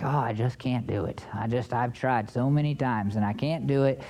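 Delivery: low, soft voice of giving up; timid, defeated. Acted. Preacher voicing Christians who keep failing, timid and defeated. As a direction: mimic a timid believer, pitch dropped and volume down. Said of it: "attitude of timidity".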